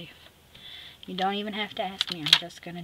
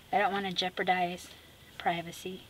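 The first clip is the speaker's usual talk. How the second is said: ordinary delivery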